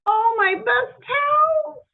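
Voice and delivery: high, loud, slow hammed-up wail; mock grief over ruined towels; wailing dismay. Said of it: "she said".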